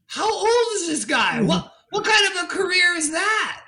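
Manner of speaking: high-pitched incredulous questions, retold; astonished. Guest retelling his amazed reaction to a friend, astonished. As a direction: act out being amazed at someone's career.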